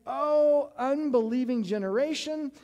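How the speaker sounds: loud, high exasperated outburst as Jesus; exasperated cry. Retold or imitated. Jesus calling out to the crowd, loud and exasperated. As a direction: throw up your hands and cry it out loud. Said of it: "He's like".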